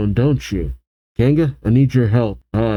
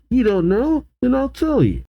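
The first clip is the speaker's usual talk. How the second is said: high, mocking villain voice; hammed-up, high-pitched condescension; gloating grunt; 12 semitones higher than usual. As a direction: taunt them with a high, singsong lift.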